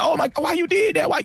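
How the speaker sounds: high, whining plea. Retold or imitated. Imitating Anton whining on the phone, high whining plea. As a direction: imitate a grown man whining. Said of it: "he was whining".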